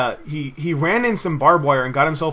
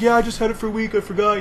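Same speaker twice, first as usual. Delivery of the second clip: higher, pleading imitation of a nervous kid; imitated near-tears; 7 semitones higher than usual. Imitating friend excusing himself to the cop, near tears. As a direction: plead an excuse as if close to crying. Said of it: "he was about to cry and stuff".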